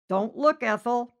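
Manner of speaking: loud, high playful cry; mock warning shout. Driver hollering a gag about the naked band, loud and playful. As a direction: holler it like a cheeky old joke.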